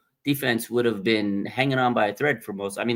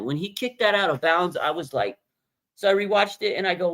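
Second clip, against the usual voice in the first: normal talking voice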